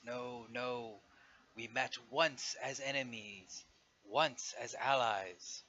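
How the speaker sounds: slow, deliberate dragon proclamation; great dragon. Acted. Dragon invites old foes to join it, slow and grand. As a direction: stretch the words like an ancient dragon making an offer. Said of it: "the great dragon's head shakes side to side and goes".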